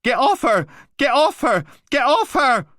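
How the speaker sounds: very high, very loud repeated scream. Acted. Voice on the recording screaming at the attacker, frantic. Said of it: "The computer speaker screamed".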